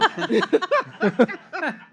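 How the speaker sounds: high, loud burst of laughter. Real, not acted. Laughing with friends at a joke, high and loud. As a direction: let a big laugh burst out.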